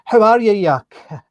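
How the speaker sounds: loud, high, drawn-out Glasgow greeting; imitated Glaswegian greeting. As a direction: imitate a Glaswegian hailing someone affectionately. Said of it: "but they say".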